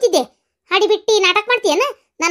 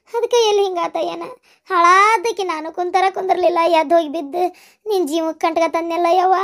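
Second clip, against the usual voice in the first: normal talking voice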